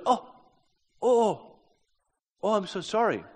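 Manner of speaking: high, dismayed "oh" into an apology; startled apology. Retold or imitated. Princess realises she has upset her guest, startled apology. Woman suddenly apologises to crying Floyd.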